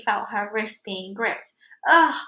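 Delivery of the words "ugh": loud straining ugh; effort grunt. Acted. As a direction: grunt hauling them up. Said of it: "she exclaimed, the alien in effort".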